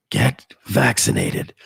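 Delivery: put-on low, breathy old politician whisper. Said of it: "Let me do my [name] impression".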